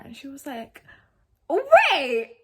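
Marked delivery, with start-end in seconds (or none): 1.5-2.3 much higher, louder "wait"; sudden realization. Retold or imitated. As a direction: snap to recognition. Sister suddenly recognizes her.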